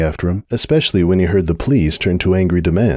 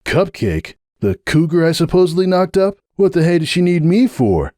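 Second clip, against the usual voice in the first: incredulous stallion, much higher; scoffing disbelief, pitched up; Hoops' voice; 6 semitones higher than usual. A narrator's reading. Hoops scoffing at Sunburst about Cupcake, incredulous.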